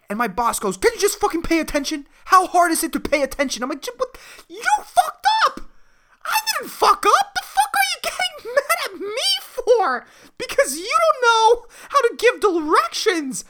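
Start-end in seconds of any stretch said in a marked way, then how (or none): 0.8-3.6 rapid, high-pitched scolding in a retold quarrel; mock angry boss. Boss scolding him in retelling. 3.8-13.4 very high, loud, comic shouting; outraged retort. Retold or imitated. Retort in acted quarrel with boss.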